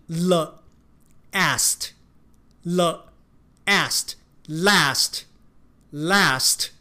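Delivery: last 的 a 念成 æ，非常慢地反复念; 慢慢拉长 last 的 æ 音; slow drawn last. Shown as a demonstration. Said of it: "last的A也是发一样的音". (极慢示范 last)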